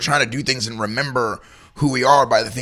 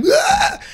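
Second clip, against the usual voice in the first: high, loud mock toddler shriek; toddler scream; 8 dB louder than usual. A toddler wailing for his juice box, a mock shriek. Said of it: "kid starts freaking out, like".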